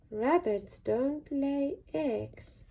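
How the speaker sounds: glum. Acted. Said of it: "said Rupert glumly".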